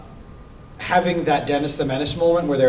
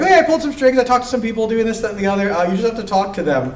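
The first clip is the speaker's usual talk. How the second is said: excited; 7 semitones higher, 3 dB louder, faster than usual. Retold or imitated. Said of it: "they're like".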